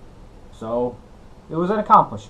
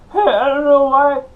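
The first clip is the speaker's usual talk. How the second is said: much higher, louder comic yelp; Bobcat Goldthwait impression; 12 semitones higher, 6 dB louder than usual. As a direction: blurt it like a frantic stand-up. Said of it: "done a Bobcat Goldthwait impression".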